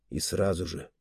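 ordinary delivery

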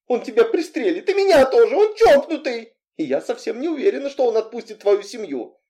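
high, agitated warning. A narrator's reading. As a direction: burst out, pitch way up. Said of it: "Разозлившись, я повысила голос".

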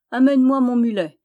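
calling out. A narrator's reading. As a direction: call out loudly to the stable boy. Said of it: "cria-t-il au garçon d'écurie". (crié au garçon d'écurie)

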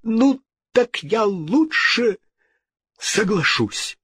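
high, slow, halting reply; distressed king. A narrator's reading. King weighing the ghost's demand, distressed. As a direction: answer high and reluctantly.

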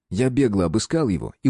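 plain reading voice

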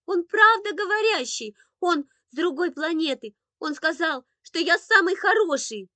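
put-on high, loud upset little boy; wailing childish protest; tearful insistence. A narrator's reading. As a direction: plead to be believed through tears. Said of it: "вдруг разрыдался Андрейка".

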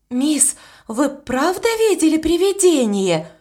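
a touch of horror in the question; mildly horrified, asking if she saw a ghost. A narrator's reading. Annie asks Miss about the ghost, mildly horrified. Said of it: "ужаснулась Энни".

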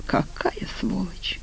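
slow, very soft, breathy curse; hushed, drawn-out contempt; bitter whisper. A narrator's reading. Bitter outburst at betraying fiancé.

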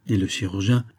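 ordinary delivery, nothing marked

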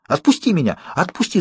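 shouting. A narrator's reading. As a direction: plead to be put down. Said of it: "закричал я".

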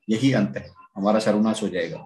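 usual speaking voice

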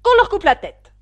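high, very loud execution order; furious shout. A narrator's reading. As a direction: shout like an enraged queen. Queen orders gardeners' execution. (cri de colère impérieux)

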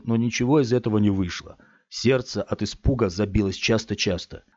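ordinary delivery

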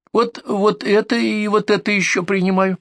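high-pitched granny voice; old woman. Acted. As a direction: list the pills as an elderly patient. Old patient shows her pills.

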